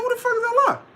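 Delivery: God hearing a name he doesn't know; comic high-pitched indignant God; baffled God. Retold or imitated. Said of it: "He's like".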